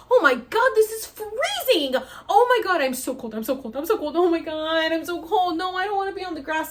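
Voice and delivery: high, loud acted wail of distress; frantic pleading, re-enacting being painfully cold; freezing panic. Patient to helpers laying her on freezing grass, panicked wail.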